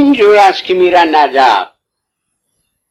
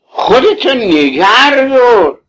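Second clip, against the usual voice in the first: slow, firm plea; 7 semitones higher, slower than usual. Retold or imitated. Quoting his own advice to hold back.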